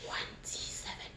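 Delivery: soft breathy whisper right in the ear. Shown as a demonstration. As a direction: whisper it close, voiceless. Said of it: "I'm going to whisper some words in your right ear".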